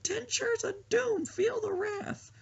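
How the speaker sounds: put-on very high, slow, cracked old lady voice; old ninja lady attacking the bad guys, shrill granny war cry; old lady battle cry. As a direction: screech it like a tiny old warrior granny. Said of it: "She'll be like".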